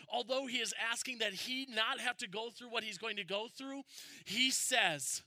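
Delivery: fervent pulpit voice; full-force preaching. Acted. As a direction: drive it home to the congregation. Preaching builds to the prayer.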